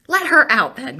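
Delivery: impassioned, exasperated cry; exasperated outburst. Real, not acted. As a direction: demand it with passion.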